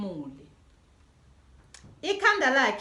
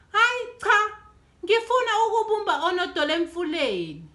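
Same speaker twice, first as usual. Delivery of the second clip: put-on higher, fast childish grumble; complaining child; 6 semitones higher, 4 dB louder than usual. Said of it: "kukhononda uDudu".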